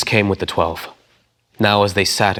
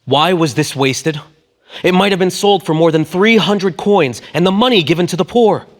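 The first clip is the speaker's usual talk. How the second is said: indignant; 10 semitones higher, 7 dB louder than usual. A narrator's reading. Disciples protesting the wasted oil, indignant and loud. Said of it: "were indignant".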